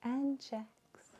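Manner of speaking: breathy whispered echo; whispering. Real, not acted. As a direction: whisper the lyric back. Echoing song lyric whispered.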